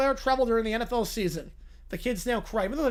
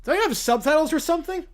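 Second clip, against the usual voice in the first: ordinary talk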